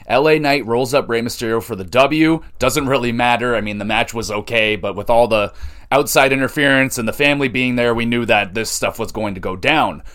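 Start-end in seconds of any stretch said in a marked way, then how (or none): none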